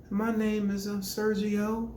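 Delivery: high, hesitant timid intro; mock a shy boy saying his name; mumbling weak boy. Imitating a boy introducing himself timidly. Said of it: "they talk like that".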